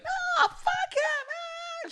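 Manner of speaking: very high, stretched falsetto; imitated angry girl. Girl warning his dance partner off, drawn-out mocking falsetto. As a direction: squeal it in slow mock indignation. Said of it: "And then she's like".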